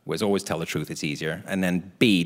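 normal talking voice

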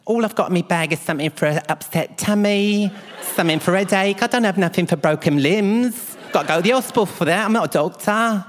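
high, complaining nurse voice; hammed-up indignant nurse; imitated set nurse. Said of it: "She goes".

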